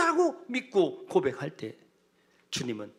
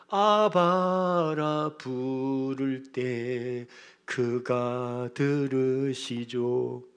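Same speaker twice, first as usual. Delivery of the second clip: drawn-out call; 5 semitones lower, much slower than usual. Acted.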